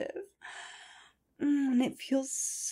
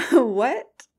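louder, laughing "What? Here?"; louder playful disbelief; mock surprise; 4 semitones higher, 8 dB louder than usual. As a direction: act startled by the request. Startled reaction, louder.

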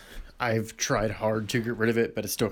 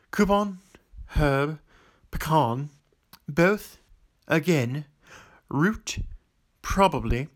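put-on amateur British accent, word by word; 4 dB louder than usual. Vlogger reading accent-challenge word list, amateur British accent. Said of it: "Let's just do British then".

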